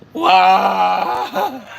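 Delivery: high, loud imitated crying howl; wailing in anguish. Drunk friend being pulled up off the couch, mock anguished wail. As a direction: bawl it like a despairing drunk. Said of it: "wailing in anguish".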